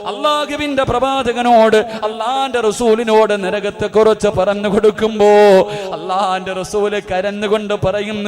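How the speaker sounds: loud, chanting sermon cry with long-drawn endings; impassioned preaching. Acted. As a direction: preach at full force, stretching the last word of each phrase. Preaches at full force. (ഉച്ചത്തിൽ ഈണത്തിലുള്ള വികാരഭരിത പ്രസംഗം)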